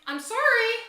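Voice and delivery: put-on sarcastic retort to the teenage boys; loud mock apology. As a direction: toss back a sarcastic apology. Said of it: "I'm like".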